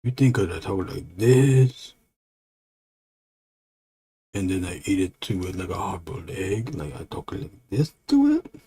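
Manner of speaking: dim-witted monster with swooping questions; lightly voiced dopey monster; stupid monster. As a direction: play a dumb monster mildly put out. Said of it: "that monster talks stupid".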